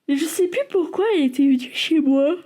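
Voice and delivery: talking through a yawn; words stretched by a yawn. Real, not acted. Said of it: "Excusez-moi, je baille".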